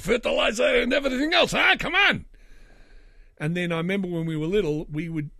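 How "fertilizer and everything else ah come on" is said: exasperated outburst. Real, not acted. Exasperated at the barter.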